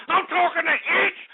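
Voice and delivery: raging yell at the chat; furious shout. Acted. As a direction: scream it at the whole chat room. Host rant peaks.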